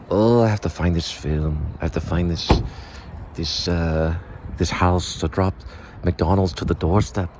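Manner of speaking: deep mock Herzog voice; Werner Herzog impression. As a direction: muse gravely like a documentary narrator. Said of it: "Yes, Werner Herzog. There he is".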